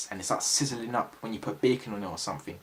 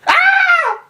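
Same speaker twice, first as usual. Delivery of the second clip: very high loud scream of fright; high pitched scream; 14 dB louder than usual. Retold or imitated. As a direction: let out a sudden high shriek. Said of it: "I turned around".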